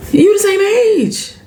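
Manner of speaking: high, slow, disbelieving; drawn-out exasperated protest. Real, not acted. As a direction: spell it out in disbelief. Exasperated at Aunt Vi.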